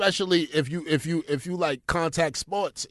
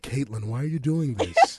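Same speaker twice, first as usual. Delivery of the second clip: lightly dramatic soap actor; soap opera voice; 4 semitones lower than usual. Pretend. Auditioning for As the World Turns, light soap drama. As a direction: audition line for a daytime soap. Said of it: "I'ma just start it off like".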